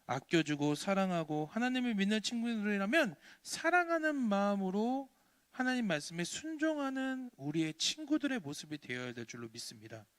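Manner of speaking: ordinary talk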